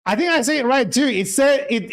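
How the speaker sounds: ordinary delivery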